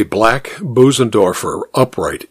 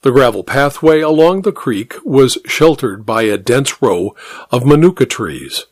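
plain reading voice

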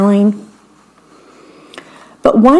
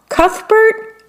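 queen guessing; 13 semitones higher than usual. Acted.

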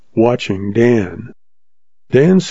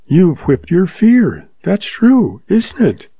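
put-on young woman June; 7 semitones higher, slower than usual. June voiced, higher.

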